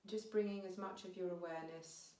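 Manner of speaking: unhurried meditation guiding with long pauses; guided meditation. Real, not acted. Teacher guiding listeners to notice their breath, calm meditation voice. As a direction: ease them gently inwards, phrase by phrase, leaving space.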